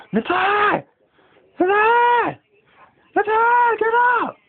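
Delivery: very high, loud shrill-free calling of his name; imitating mum yelling him awake upstairs; mock mum yelling. Son retelling how mum yelled him out of bed, very high and loud.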